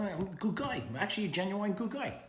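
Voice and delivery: self-mocking naive voice. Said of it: "I was going".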